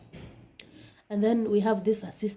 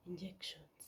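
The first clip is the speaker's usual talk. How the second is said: whispered dread; scared whispered word; frightened whisper; 4 semitones lower, 21 dB quieter than usual. Retold or imitated. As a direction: breathe the word in fear. Retold fear whisper.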